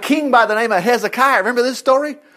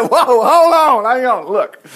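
higher, louder, slow, desperate plea to the prophet; pleading captain; 4 semitones higher, 4 dB louder, slower than usual. Acted. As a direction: beg him to stop, frantic. Captain begs on his knees.

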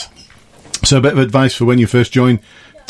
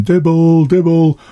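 a higher, lightly shouted taunt of Dibble; imitated street jeer; 7 semitones higher, slower than usual. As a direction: call Dibble out like a Manchester kid mocking police. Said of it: "you'd hear 'em shouting".

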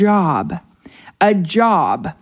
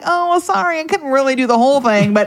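higher-pitched mock apology; sheepish excuse; 5 semitones higher than usual. Woman backing out of a deal with God, mock-sheepish. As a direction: wriggle out of a promise, lightly acted.

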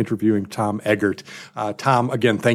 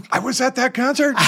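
high, excited exclamation; high-pitched gleeful surprise; amazed delight; 12 semitones higher than usual. Real, not acted. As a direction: blurt it out, thrilled by the coincidence. Discovers both attended the same concert.

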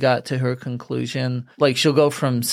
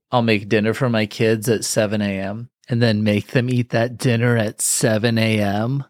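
ordinary talk

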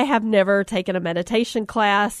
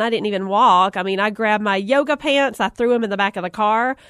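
usual speaking voice

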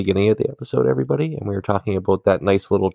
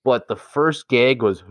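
ordinary talk, nothing marked

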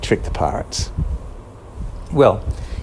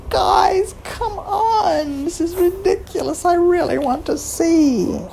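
very high squeak, laughing, then slow; exasperated little mouse; tiny mouse fed up, giggling; squeaky mouse; slower than usual. Acted.

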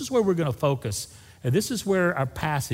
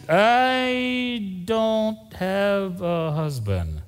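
drawn-out, very slow, guarded reply; a hint of a cornered woman; evasive woman; 6 semitones higher, much slower than usual. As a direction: stretch it out, dodging the question.

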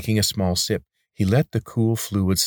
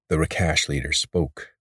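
usual speaking voice

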